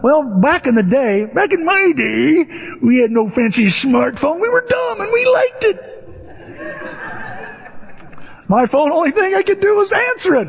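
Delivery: high, mocking old-man voice; high hammed-up grumpy oldster; old-timer. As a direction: play a cranky old-timer. Old-timer "back in my day" bit.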